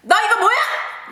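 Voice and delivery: high, rapid mock scolding; angry teacher. Teacher confronting pupil over her diary, sharp imitated scolding. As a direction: demand it like an outraged teacher.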